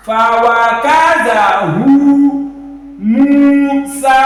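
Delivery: slow chanted "Fawakazahu Musa" with long notes; stretched vowels. Shown as a demonstration. Teacher reciting the example, "Fawakazahu Musa" stretched. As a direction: hold the "hu" and both vowels of "Musa". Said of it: "This is our emphasis".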